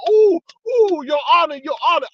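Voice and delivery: high, loud eager calling out; half-jumping for the judge's attention; eager courtroom call. Retold or imitated. Retelling the courtroom, calling out to the judge, high and excited.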